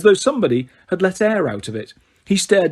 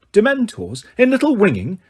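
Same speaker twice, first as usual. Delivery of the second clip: higher, startled disbelief; great surprise; 7 semitones higher than usual. A narrator's reading. Madam Bones startled before the court, great surprise. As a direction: sound truly taken aback by the news. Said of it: "in a tone of great surprise".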